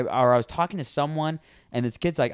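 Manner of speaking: normal talking voice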